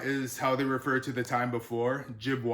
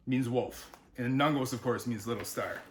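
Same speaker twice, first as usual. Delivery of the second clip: usual speaking voice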